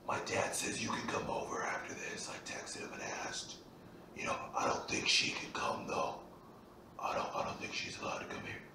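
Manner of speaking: quick excited whisper. Retold or imitated. Friend inviting Zach over mid-movie, whispered fast. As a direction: rush the invite under your breath.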